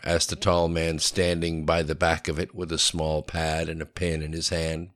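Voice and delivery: plain reading voice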